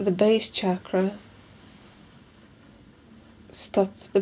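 slow, measured meditation guide with long pauses; guided meditation. Acted. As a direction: guide them calmly through each breath, leaving room between phrases.

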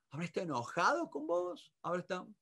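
soft, careful father-and-son exchange; hushed, understated concern; acted gentle talk. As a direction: probe quietly at a sad child's level. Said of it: "está a mi nivel".

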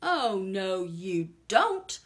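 loud, firm villain declaration; rat declaring. Acted. The highway thief refusing the ants, loud and firm. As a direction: shut the ants down. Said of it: "declared the highway thief".

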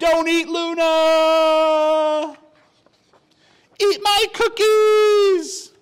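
high, loud and slow, pleading; pleading shout. Acted. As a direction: shout it as you charge the wolf. Bunny shouts at wolf.

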